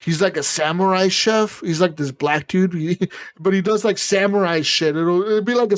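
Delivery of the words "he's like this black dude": ordinary talk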